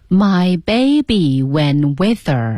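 very slow, drawn-out repetition; slow read-aloud practice. Shown as a demonstration. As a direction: stretch every word for learners to follow. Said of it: "来读啊". (很慢地拉长每个词跟读)

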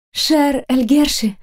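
slow drawn-out greeting; drawling. A narrator's reading. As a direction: stretch each syllable out slowly. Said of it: "протянула Нериента".

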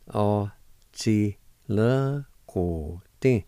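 tí le go tí slowly, syllable by syllable; slow pronunciation. Shown as a demonstration. Teacher to students learning Cherokee, slow careful sounding out. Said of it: "it's pronounced like this".

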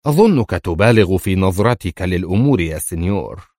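ordinary delivery

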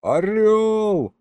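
high, ringing shout; yelled praise. A narrator's reading. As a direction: holler congratulations to a friend. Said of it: "проорал я ему".

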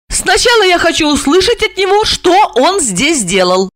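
high, insistent female voice; determined, pitched high; decisive. A narrator's reading. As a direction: lay down the condition firmly. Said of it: "решительно отрезала она".

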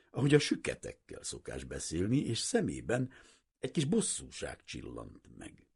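ordinary delivery

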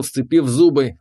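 ordinary delivery

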